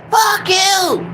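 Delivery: shrill high parrot squawk, way up in pitch; squawking parrot swearing at its owner. Retold or imitated. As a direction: screech it like a cursing parrot. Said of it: "that's all he kept on saying".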